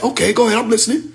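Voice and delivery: high, sneering mimic with a laugh; mocking sarcastic quote. Mimicking Ibrahim's dismissive reply to him, sneering and laughing.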